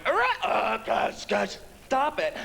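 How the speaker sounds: high, hammed-up monster squawking; mock monster fight. Boyfriends fighting in the hallway, imitated high monster squabble. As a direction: squeal like battling movie monsters, slowing down.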